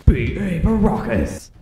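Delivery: lightly mimicked echoing announcer; game announcer imitation. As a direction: draw out the name like an announcer. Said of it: "gotta have that echo in the voice".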